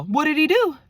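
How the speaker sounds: high, loud, incredulous outburst; retold shocked question. Cousin hearing of the jailing, shocked demand. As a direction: imitate a nosy cousin bursting out, pitch way up.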